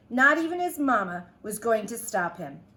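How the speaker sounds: usual speaking voice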